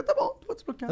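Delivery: high mock quote. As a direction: jump up high as if quoting yourself. Quoting himself agreeing to unblock. (citação em tom bem agudo)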